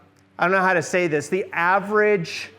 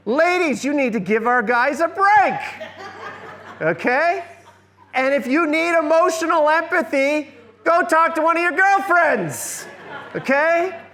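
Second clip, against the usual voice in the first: raised, laughing mock lecture to the ladies; playful scolding; 8 semitones higher than usual. Lecturer teasing the women in class, high and laughing.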